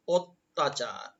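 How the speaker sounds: অত্যাচার slowly as "ot-ta-char"; correct pronunciation demo. Shown as a demonstration. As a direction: stretch out অত্যাচার syllable by syllable, "ot-ta-chaar". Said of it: "অত্যাচার শব্দটির উচ্চারণ হবে".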